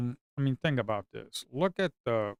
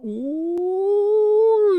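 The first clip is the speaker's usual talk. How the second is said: excited squeal; 12 dB louder than usual. Real, not acted. Thrilled squeal at a chart.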